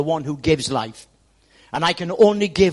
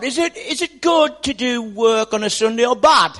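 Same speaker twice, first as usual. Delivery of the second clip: mock shout; 6 semitones higher, 6 dB louder than usual. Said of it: "So he shouts out".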